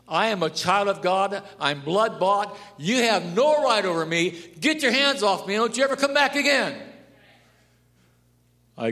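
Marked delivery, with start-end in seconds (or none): 0.1-6.8 louder and louder, higher at the end; rising commanding rebuke. Shown as a demonstration. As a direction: command the devil to leave, building. Rebuking Satan, loud climbing commands.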